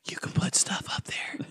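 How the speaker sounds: hushed mock amazement; mock-confidential whisper. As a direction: whisper it like a secret discovery. Joins the whispered clueless bit.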